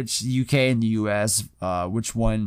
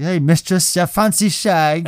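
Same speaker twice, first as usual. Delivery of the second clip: louder, higher put-on British voice; fake British accent; 5 semitones higher, 4 dB louder than usual. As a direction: imitate a guy faking a British accent. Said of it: "the guy faked the British accent".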